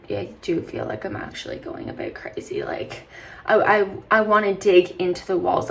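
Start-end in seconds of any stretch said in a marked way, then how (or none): 0.1-3.1 hushed, voiceless admission she's going crazy; a half-crazed whisper; whispered confession. Real, not acted. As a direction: breathe it out like a secret.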